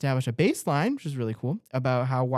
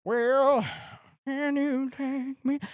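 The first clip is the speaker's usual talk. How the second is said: high mock voice; 14 semitones higher, much slower than usual. Dramatic high mock hand-off.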